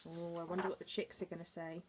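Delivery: ordinary delivery, nothing marked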